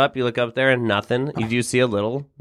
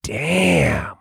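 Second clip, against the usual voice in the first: seductive witch; much slower than usual. Acted. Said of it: "And then she goes".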